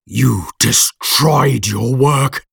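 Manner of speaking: slow monster voice, words drawn out; monster's accusation. A narrator's reading. Monster confronts his creator over the destroyed work, slow accusing creature voice.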